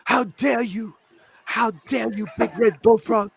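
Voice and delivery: high, indignant mock outrage; quick parody of a furious young activist; Greta impression. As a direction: channel Greta's how dare you, hammed up. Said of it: "Thanks, Greta".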